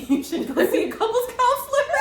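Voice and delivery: breaking into loud high laughter; laughing at her own suggestion. Real, not acted. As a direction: giggle through the advice. Cracking up at own advice.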